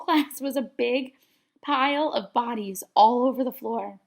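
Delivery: usual speaking voice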